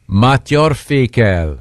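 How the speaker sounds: slow attempt at a French accent; French pronunciation. Shown as a demonstration. Asked by co-host for the French pronunciation, slow French accent. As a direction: say it carefully as French. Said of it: "what is the French pronunciation".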